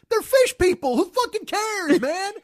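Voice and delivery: high, drawn-out disbelief; exasperated rant. Retold or imitated. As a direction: throw up your hands at the screen. Retold rant at the screen.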